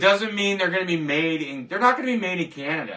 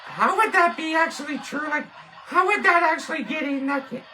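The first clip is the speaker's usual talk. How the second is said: much higher, puppet-like sidekick gawking at the can; high cartoon sidekick gasping at a product; 12 semitones higher than usual. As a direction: play the squeaky sidekick, amazed. Same puppet voice, laughing doubt.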